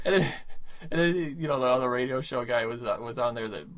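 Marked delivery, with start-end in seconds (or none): none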